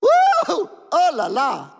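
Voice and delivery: high joyful whoop to the crowd; excited whoop. Real, not acted. Preacher whooping to fire up the congregation, excited. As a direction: let out a big celebratory woo.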